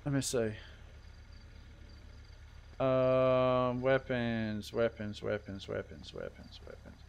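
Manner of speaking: muttering a word over and over, trailing into a whisper; fading mutter. Real, not acted. Admin scrolling the weapon menu, mutters to himself, fading to a whisper.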